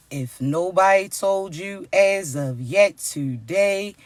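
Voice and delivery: ordinary delivery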